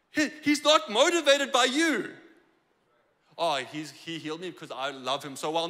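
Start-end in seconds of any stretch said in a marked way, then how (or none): 3.4-5.7 low, soft mocking quote; mock self-satisfied believer. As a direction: drop low to imitate a complacent churchgoer. Voices a smug believer's claim.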